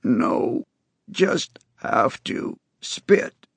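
slow breathy rasp; a hoarse whisper, dragging words; raspy whisper. A narrator's reading. Boy's damaged voice, whispered.

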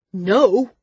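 narrator's acted shriek, moderately strong; shrieked refusal. A narrator's reading. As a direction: cry out the word in fury. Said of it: "He shrieked the word".

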